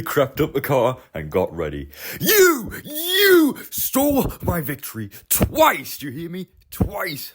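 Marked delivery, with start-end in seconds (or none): 2.2-7.3 high, slow accusing engine; angry shout. Acted. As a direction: accuse Car of stealing victory. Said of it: "shouted Sir Handel".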